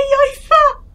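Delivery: high squealing mockery; mocking laugh. Real, not acted. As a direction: squeal it out with glee. Mocking an enemy who misses.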